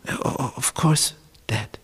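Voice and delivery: hushed, stammering reassurance; faltering liar. Acted. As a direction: stutter it softly, nearly whispered. Jacob falters, answering father.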